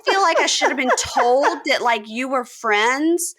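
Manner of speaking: prissy explanation, hammed up; high mock Taylor. Taylor to Tanya, high prissy complaint. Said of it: "And Taylor goes".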